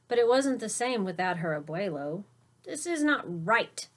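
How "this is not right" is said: declared. A narrator's reading. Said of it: "declared young Sophia".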